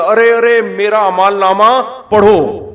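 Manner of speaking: loud, high, overjoyed cry to everyone; a believer loudly announcing his good record to the crowd; joyful calling out. Acted. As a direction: call out in delight, slowing on the last words.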